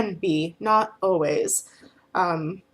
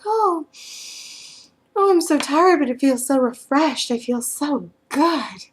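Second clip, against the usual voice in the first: high, loud drowsy gushing, slowing at the end; groggy happy client; 7 semitones higher, 4 dB louder than usual. Retold or imitated. Client wakes up and gushes to the feeder, high and dreamy. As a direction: gush like someone just waking refreshed.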